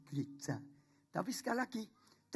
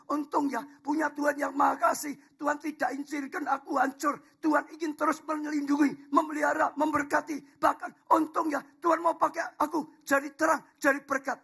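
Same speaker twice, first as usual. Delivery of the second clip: higher and louder praise; grateful preaching swell; 8 semitones higher, 6 dB louder than usual. Acted. Pastor to the congregation, swelling into grateful praise. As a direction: lift the thanks up to the rafters. Thankful refrain rising to preaching.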